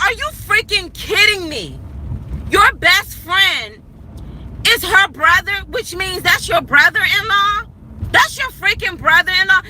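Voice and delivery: are you kidding me, high and stunned; retelling her shock at the news, voice climbing high; incredulous disbelief. Retold or imitated. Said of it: "I'm thinking".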